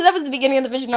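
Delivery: normal talking voice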